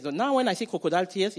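ordinary talk, nothing marked

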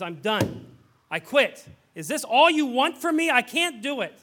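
loud, high, desperate outburst; retold shout. Young man storming out of the house, desperate shouting. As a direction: yell it as you storm out of the house.

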